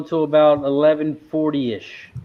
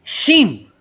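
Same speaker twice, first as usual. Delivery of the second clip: Italian accent; 9 semitones higher, 5 dB louder than usual. Shown as a demonstration. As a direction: say Sheem like an Italian, sh not sk. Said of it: "It's my Italian accent".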